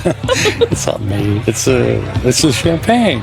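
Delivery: slow, laughing through the words; tipsy laughing. Acted. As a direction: giggle it off, a bit drunk. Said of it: "I'm slightly tipsy".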